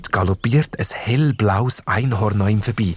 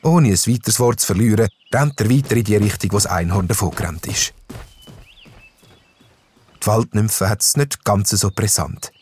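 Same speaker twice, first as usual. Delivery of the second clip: usual speaking voice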